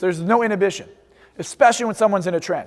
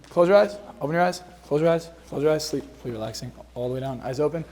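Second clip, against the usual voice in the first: softer, lower trance commands sinking down; hypnotic induction; 5 semitones lower, 6 dB quieter than usual. Shown as a demonstration. Hypnotist lulling a volunteer on stage, soft and low. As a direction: lull them down into sleep. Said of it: "hypnotist demonstrating induction on volunteer".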